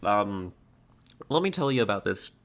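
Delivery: usual speaking voice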